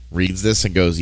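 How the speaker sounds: usual speaking voice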